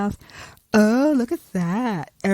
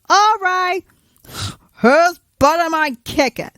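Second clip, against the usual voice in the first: high, loud eager hero; ready for a fight, high and brash; Captain Marvel; 7 semitones higher, 4 dB louder than usual. Acted. Captain Marvel arriving for the fight, loud and eager.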